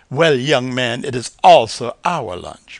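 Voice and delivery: loud, haughty lady. A narrator's reading. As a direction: put the youngster in his place. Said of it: "Marigold said haughtily".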